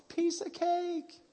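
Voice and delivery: high, drawn-out sing-out; mock breezy runner. Imagined runner making light of a marathon, mock breezy. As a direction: stretch it out in a high put-on voice. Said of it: "marathon runner is like".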